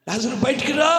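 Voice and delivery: commanding summons, lifted and projected; calling out. Retold or imitated. Preacher voicing Jesus summoning dead Lazarus, calling out. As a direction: call him out of the tomb. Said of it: "అని పిలిచాడంతే".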